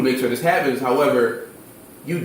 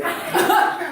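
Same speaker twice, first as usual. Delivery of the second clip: high breathy laugh. Real, not acted. Laughs at school chaos.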